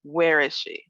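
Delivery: ordinary talk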